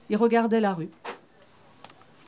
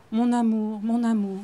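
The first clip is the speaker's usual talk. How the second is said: trembling voice; slower than usual. A narrator's reading. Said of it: "Ma voix s'est mise à trembler".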